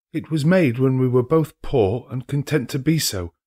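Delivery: lightly voiced grieving girl; young girl's voice. A narrator's reading. The girl tells Scrooge another idol replaced her, sadly.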